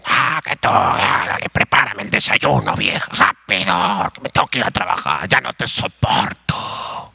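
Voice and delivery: hammed-up rough, growly husband; mock-angry rasping husband; harsh, curt husband. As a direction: play a husband roughly ordering his wife about. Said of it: "comienza a hablarle bien golpeado".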